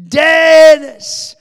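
loud, high, drawn-out shout; acted prayer shout, stretched long; crying out in prayer. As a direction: cry the name out in re-enacted desperate prayer. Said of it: "I began to pray louder and louder".

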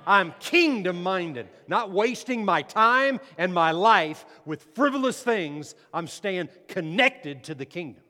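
loud, high preacher's rallying shout; fired-up, emphatic preaching; like you mean it. Real, not acted. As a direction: rouse the congregation at full force. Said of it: "say it like you mean it".

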